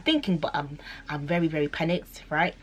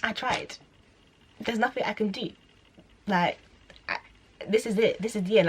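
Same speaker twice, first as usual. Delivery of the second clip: despair; 5 semitones higher than usual. Retold or imitated. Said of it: "I just thought".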